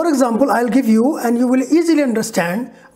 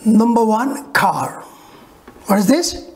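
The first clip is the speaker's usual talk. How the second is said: normal talking voice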